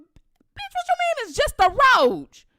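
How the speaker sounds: high loud indignant comeback; arguing with auntie over roach; heated retort. Retold or imitated. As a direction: snap back at auntie.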